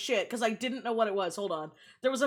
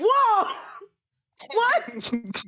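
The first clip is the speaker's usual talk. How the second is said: retelling her shock at a crude line; shocked whoa; 7 semitones higher than usual. Retold or imitated. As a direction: replay the jolt of surprise.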